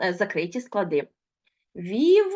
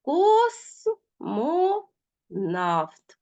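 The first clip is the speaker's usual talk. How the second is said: very slow, each syllable spaced apart; 6 semitones higher, much slower than usual. Shown as a demonstration. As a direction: spell out Безпідставний slowly by syllables. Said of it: "teacher splits word into syllables". (повільно, по складах)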